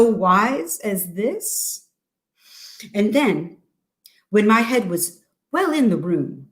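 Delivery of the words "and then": ordinary delivery, nothing marked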